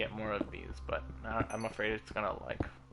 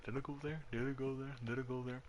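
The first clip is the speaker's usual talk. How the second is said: self-mocking mutter; 11 dB quieter, faster than usual. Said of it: "keep walking by and go".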